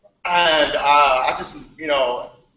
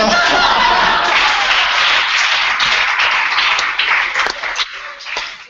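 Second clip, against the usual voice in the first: long, high, breathy laughter; long real laugh; 4 dB louder than usual. Real, not acted. Ending the story for the hangout, laughing. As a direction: laugh it all out as the story ends.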